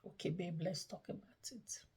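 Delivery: soft, calm invitation to talk. Retold or imitated. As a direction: gently ask your partner to talk. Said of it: "But when you calm down".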